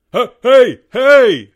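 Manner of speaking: high, loud calling scream. Acted. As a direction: yell to grab everyone's attention. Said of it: "screamed Albert suddenly".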